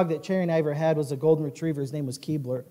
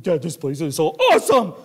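happy dog bursting on the last word; super excited dog; 6 dB louder than usual. Acted. Dog tells his dad about the place, excited. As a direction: gush like a thrilled retriever. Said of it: "He's just super excited".

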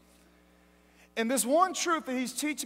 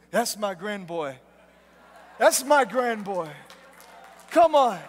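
proud grandfather; 3 semitones lower, 5 dB louder than usual. Retold or imitated. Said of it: "he was in heaven with Jesus saying".

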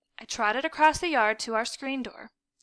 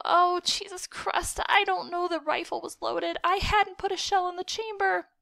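high-pitched distressed wailing; panicked wail; 7 semitones higher than usual. A narrator's reading. Boy insisting he didn't load the rifle, wailing. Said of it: "I wailed".

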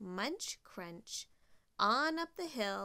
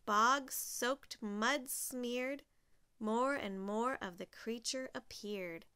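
ordinary delivery, nothing marked

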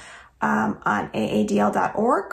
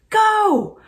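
shouted start; 7 dB louder than usual. Acted. Starting a race.

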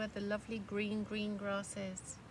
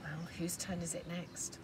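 plain reading voice

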